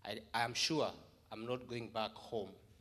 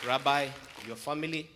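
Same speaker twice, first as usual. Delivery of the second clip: calling out with feeling, loud; loud emotional address; 3 semitones higher, 12 dB louder than usual. Real, not acted. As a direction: voice raised in heartfelt farewell. Emotional farewell address to congregation.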